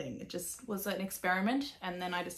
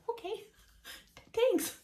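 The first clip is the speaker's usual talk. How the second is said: imitating her own squeaky reply, much higher; mock high squeak; 14 semitones higher, slower than usual. Retelling her answer to Laura, mock high squeak. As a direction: squeak out a mock-bashful thanks.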